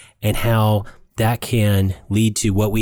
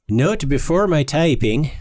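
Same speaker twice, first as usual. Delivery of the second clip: playful put-on Swedish accent, pitched a little higher; mock Swedish accent; 5 semitones higher than usual. Host reading a Swedish listener's comment in mock Swedish accent. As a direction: read it like a jokey Swede. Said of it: "I had to do my Swedish accent".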